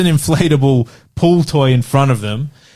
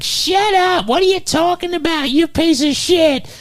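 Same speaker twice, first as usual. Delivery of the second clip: very high squawked falsetto nagging; mock berating woman. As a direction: imitate a scolding wife in extreme falsetto. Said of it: "a woman comes out from behind".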